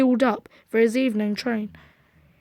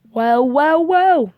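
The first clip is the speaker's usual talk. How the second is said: mock surprise; 3 semitones higher, 4 dB louder, slower than usual. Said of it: "he exclaimed".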